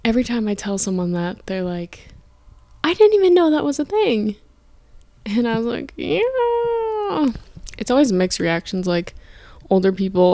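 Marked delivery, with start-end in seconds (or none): 2.8-4.4 surprised quote. Retold or imitated. Quoting people's surprised reaction. 6.0-7.3 high chirpy yeah. Retold or imitated. Her own reply, quoted.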